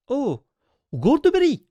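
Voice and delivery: like hitting the jackpot; ecstatic cry. Real, not acted. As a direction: yell the name out in delight. Excited by pulling Goldberry.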